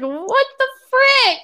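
high, drawn-out mock shriek, slow and loud; retold outraged disbelief. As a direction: act out a slow, appalled outburst. Retold shocked reply to boy.

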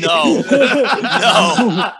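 real laughter breaking through a short no; laughing. Real, not acted. Laughing at own pick.

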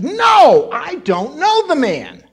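high, loud, drawn-out shout of denial; vehement denial. Acted. Preacher acts out Peter swearing to his accusers, vehement shout. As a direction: swear it out loudly, stretching the words. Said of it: "So he swore. He says".

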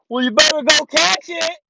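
high, loud mock shout; yelled punchline. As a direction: holler the corny punchline. Yelled punchline of prank gag.

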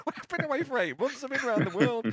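hammed-up high-pitched exasperation; mock indignant protest. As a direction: protest in a squeaky, incredulous pitch. Acted protest to unimpressed mates.

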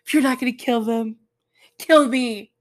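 loud, fast whiny outburst; hammed-up angry Jonah; mock tantrum. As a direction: throw a childish fit. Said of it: "Jonah's like".

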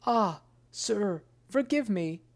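frightened merchant crying out for mercy; pleading cry. Acted. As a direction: beg the genius to forgive you. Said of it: "cried the merchant".

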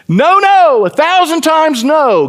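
high, loud, slow ringing no; emphatic shout. Real, not acted. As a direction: shout the denial to the rafters. Preacher emphatically refutes false teaching.

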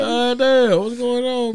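high, loud shocked cry; shocked outburst. Real, not acted. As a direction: blurt it out in disbelief.

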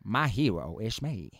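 low, slow Texan drawl in English; Matthew McConaughey impression. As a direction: do McConaughey's lazy Texas drawl. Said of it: "como siempre habla". (imitación de McConaughey con acento tejano)

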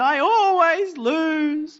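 dad hams up his son's tearful complaint; mock child wailing. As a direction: stretch it out like a sobbing seven-year-old. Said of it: "he burst into tears again".

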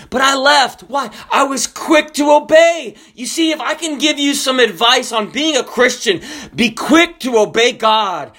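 high, punched pulpit exhortation; forceful preaching. Real, not acted. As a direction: drive the lesson home, stretching the key phrases. Preacher drives home the lesson.

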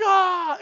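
high loud mock roar; imitating the friend's war cry; Hulk roar. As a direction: throw a roar with the crate. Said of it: "He's like".

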